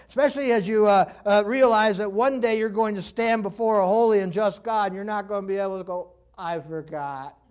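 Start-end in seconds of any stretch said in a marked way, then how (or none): 6.4-7.4 low, slow mock forgetfulness; feeble excuse. Excuse offered before a holy God, low and feeble. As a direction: let it drop, drawn out and soft.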